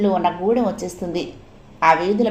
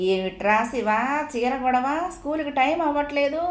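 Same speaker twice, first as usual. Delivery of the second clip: mock call from outside, pitch rising; 6 semitones higher, 4 dB quieter than usual. A narrator's reading. Mother calling in from outside about school time, mild. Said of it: "బయట నుండి అరిచింది మాణిక్యమ్మ".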